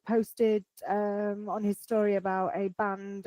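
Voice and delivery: ordinary talk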